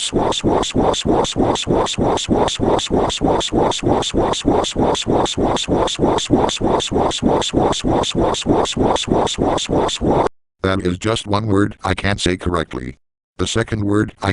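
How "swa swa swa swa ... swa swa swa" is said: rapid airy "swa" noise on a loop; repeated swoosh glitch. Shown as a demonstration. Showing viewers the rafflecopter glitch, repeated swoosh noise. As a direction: stutter out unvoiced swooshes like a rotor. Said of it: "Here's an example. My rafflecopter goes".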